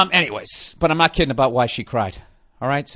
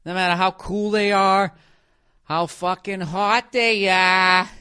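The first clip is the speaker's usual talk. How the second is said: ordinary talk, nothing marked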